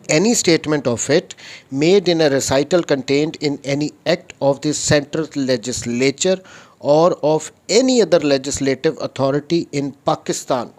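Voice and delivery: slow, deliberate reading of the law; reading statute aloud. Shown as a demonstration. Lecturer reads the article to his students, slow and deliberate. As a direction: read every word out like a legal text.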